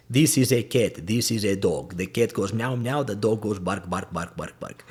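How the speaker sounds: elementary English lesson in a foreign accent; schoolroom accented English. Shown as a demonstration. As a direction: recite simple sentences with accented vowels. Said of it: "they're teaching elementary stuff like".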